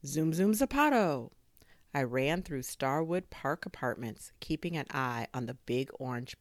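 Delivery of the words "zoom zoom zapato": higher, louder launch cry; excited catchphrase. A narrator's reading. Kid racing after the drone, excited cry. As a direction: punch it out like a superhero catchphrase. Catchphrase while running at super speed.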